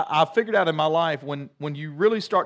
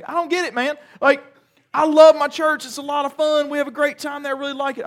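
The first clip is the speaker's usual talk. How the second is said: put-on much higher, casual teen voice; imitating his son; 10 semitones higher, 5 dB louder than usual. Son shrugging off his dad, imitated teen voice. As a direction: play the laid-back kid. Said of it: "this is how he talks to me".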